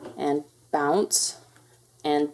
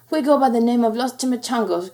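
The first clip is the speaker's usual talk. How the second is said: loud, higher growly Chihuahua; growling; 4 semitones higher, 5 dB louder than usual. A narrator's reading. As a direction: lightly growl it like a little gang boss. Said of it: "growled Don Diego".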